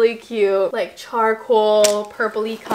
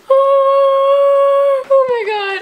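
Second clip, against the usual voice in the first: high, loud gasping oh; surprised squeal; 13 semitones higher, 6 dB louder, much slower than usual. Real, not acted. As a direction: squeal with sudden delight. Gasp at package.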